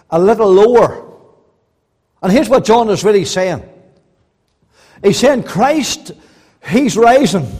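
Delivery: loud, ringing pulpit delivery; fervent sermon voice; full-force preaching. Acted. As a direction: preach it out to the whole hall. Preacher building again.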